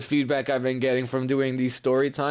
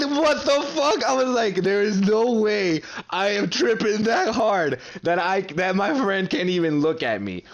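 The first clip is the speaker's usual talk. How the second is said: disbelief; 6 semitones higher than usual. Retold or imitated. Panicked tripping disbelief.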